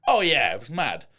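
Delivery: usual speaking voice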